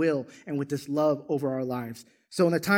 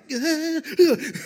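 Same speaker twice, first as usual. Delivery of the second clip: high wordless startled wail; high mock cry of alarm; startled jack-in-the-box noise; 11 semitones higher, 3 dB louder than usual. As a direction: mime the jolt of a pop-up scare. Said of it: "There's like a Jack in a Box is like".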